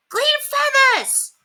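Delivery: put-on very high, slow drawn-out kid's yell; yelling child. A narrator's reading. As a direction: yell it while batting feathers. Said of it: "yelled Cassiopeia".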